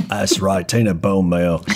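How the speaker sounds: low put-on voice confirming a name; [name] in character. [name] introduces Tina to Boz, low character voice. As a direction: drop low and savour the name.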